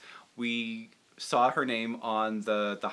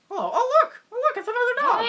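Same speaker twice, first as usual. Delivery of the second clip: high, loud, excited dog puppet voice; 6 dB louder than usual. Acted. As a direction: squeal with glee at the other dog. Puppet spots another dog.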